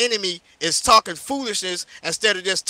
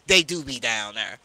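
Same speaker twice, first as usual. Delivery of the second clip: mocking imitation; 10 semitones lower, 5 dB quieter than usual. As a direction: lower mock quote of the brother. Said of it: "he come in and say".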